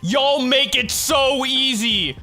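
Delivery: high, loud and very slow, savoring each word; drawn-out meme delivery. Pretend. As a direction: stretch it like a smug meme.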